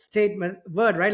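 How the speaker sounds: usual speaking voice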